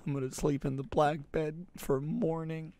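normal talking voice

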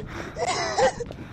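high squealing laugh; shrieking laugh. Real, not acted. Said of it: "I'm crying 'cause I saw".

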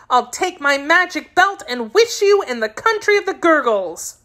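put-on high-pitched girlish threat; getting provoked. A narrator's reading. Girl threatening to wish her kitten away, provoked. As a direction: threaten crossly in a child's high voice. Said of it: "getting provoked".

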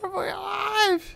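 slow, high, wailing copy of the game voice; imitating game voice. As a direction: drag it out high like a crying game character. Echoes the game voice line.

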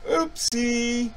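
high, playful oopsie; a cheeky fake-innocent oops; mock oopsie. Mock oops after exorcism joke.

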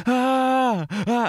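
put-on high, loud alarmed child; panicked child cry. Son suddenly desperate to pee, high loud cry. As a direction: yelp like a toddler about to wet himself.

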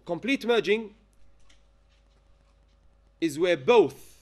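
slow, phrase by phrase with long pauses; measured dictation pace, broken into short chunks. Real, not acted. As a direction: dictate it for students writing it down. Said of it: "say slowly".

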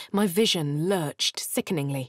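ordinary talk

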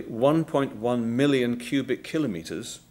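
slow, deliberate delivery of a big figure; measured, emphatic slow-down so it sinks in; said slowly. Real, not acted. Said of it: "I'll say this slowly".

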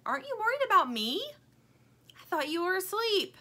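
high, different put-on voice; Stingray's voice. A narrator's reading. Stingray wanting attention from Lumpy, high plaintive voice. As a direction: pout for attention.

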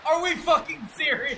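loud high angry yelling; panicking gamer. Real, not acted. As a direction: yell at the game in disbelief. Gamer yells at teammate.

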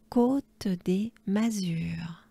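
Côte des Mazures slowly and voiced; slow voiced repeat. Shown as a demonstration. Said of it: "repeated slowly aloud".

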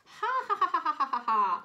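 very high, loud burst of acted laughter, tumbling down; hammed-up roar of laughter; mocking crowd laughter. A narrator's reading. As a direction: explode into staccato ha-ha-ha. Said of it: "laughed all the other animals".

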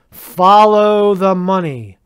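slow, loud, every word weighed; emphatic. Real, not acted. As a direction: drive the point home slowly. Loud slow punchline about bankers.

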